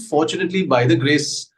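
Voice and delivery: ordinary delivery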